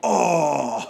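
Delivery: loud drawn-out mock crowd reaction; crowd's shocked "oh". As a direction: groan like a stunned crowd, loudly. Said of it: "everyone in the whole area went".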